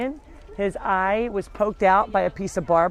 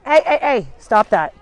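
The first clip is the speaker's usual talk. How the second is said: loud, raised-voice interruption; sharp scolding; 2 semitones higher, 7 dB louder than usual. Real, not acted. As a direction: break off to stop a misbehaving dog. Interrupts talk to scold animal.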